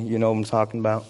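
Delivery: ordinary talk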